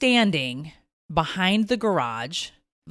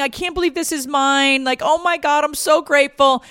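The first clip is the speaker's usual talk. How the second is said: tearful gratitude; 9 semitones higher, 5 dB louder than usual. Retold or imitated. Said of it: "She was crying".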